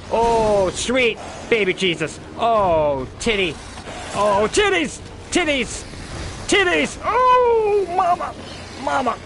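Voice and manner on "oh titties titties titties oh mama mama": over-the-top excited hollering; hyped squealing. Real, not acted. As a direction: scream it out in giddy delight. Hyped at gory kill.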